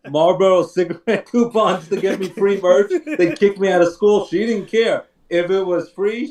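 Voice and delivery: ordinary talk, nothing marked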